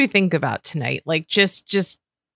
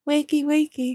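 put-on high, cooing baby talk; 9 semitones higher, 4 dB quieter than usual. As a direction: coo it like a baby. Mocking Brianna's baby voice.

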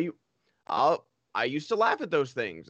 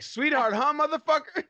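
high, pouncing mock challenge; mock confrontation; 12 semitones higher, 4 dB louder than usual. Co-host imagined confronting speaker after the show, mock confrontation. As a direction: confront them in a hammed-up, raised-pitch voice. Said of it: "pull up on me after the show and be like".

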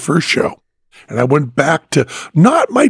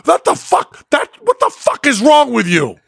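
high, outraged shouting; angry yell; 11 semitones higher than usual. Retold or imitated. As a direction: blast him for eating it. Biker keeps yelling at Penn.

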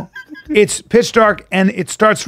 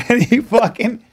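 usual speaking voice

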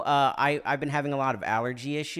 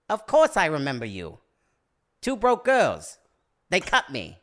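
high, loud, punchy impression; hammed-up impression of an older actor; big, emphatic actor impression, pitch leaping; James Hong; 3 semitones higher, 7 dB louder than usual. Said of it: "much better James Hong impression than me".

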